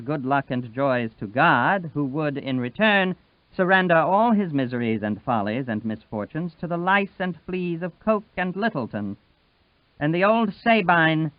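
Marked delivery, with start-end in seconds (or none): none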